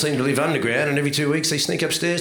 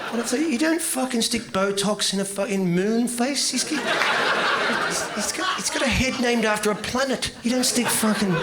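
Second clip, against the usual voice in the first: higher, incredulous rant, laughing through it; mock outrage; 7 semitones higher than usual. Comic ranting at the audience about Bert's face. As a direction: pile on the disbelief.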